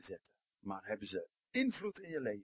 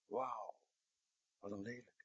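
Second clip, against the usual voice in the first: hushed dismay; 11 dB quieter than usual. Acted. Looking in the mirror, aghast.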